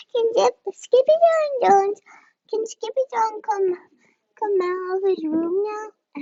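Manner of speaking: put-on high, eager child's question; little sister voice. A narrator's reading. Little sister asking mother to let her brother out, high childlike. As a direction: ask brightly as a small sister. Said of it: "asked Jujubee".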